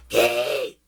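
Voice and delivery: loud, abrupt yell; mock yell. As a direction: imitate someone yelling in complaint. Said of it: "there's no one to yell".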